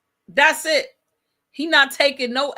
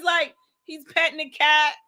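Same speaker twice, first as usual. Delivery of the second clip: normal talking voice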